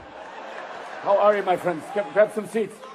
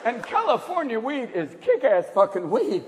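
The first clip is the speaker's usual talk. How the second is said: usual speaking voice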